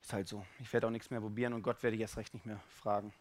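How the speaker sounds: low, soft voice of giving up; quiet, defeated acceptance; resigned. Acted. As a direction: say it as someone who has quit trying. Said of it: "dass du sagst".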